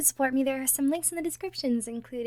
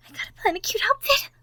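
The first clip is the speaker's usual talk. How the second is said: high, loud and fast; giddy outburst; excited squeal; 9 semitones higher, 4 dB louder, faster than usual. Acted. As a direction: burst with glee. Bursts into excitement.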